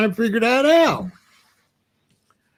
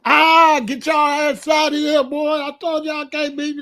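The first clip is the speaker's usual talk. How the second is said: trash-talk hollering; 7 semitones higher than usual. Retold or imitated. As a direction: yell it like you just won the game. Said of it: "The only hollering I'm doing is on the N B A".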